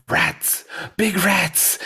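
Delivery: high, strained cry from a dream; hammed-up sleeper's panic; mock alarm. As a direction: cry out as if waking from a nightmare. Sleeper crying out from rat dream.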